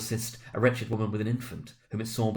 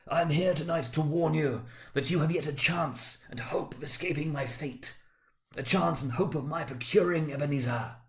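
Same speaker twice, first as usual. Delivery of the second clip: a ghost mourning wasted life; mournful ghost; 5 semitones higher than usual. Acted. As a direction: lament like a doomed spirit. The ghost warns.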